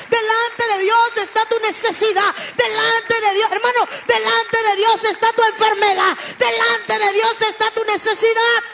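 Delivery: loud, driving preacher's cry; fervent preaching. Real, not acted. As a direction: hammer each line out at full force. Preacher's climax, repeated crescendo. (predicando a gritos con fervor)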